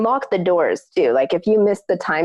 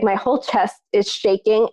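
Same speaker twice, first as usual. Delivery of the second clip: usual speaking voice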